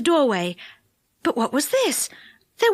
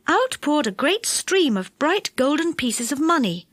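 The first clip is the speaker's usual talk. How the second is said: ordinary delivery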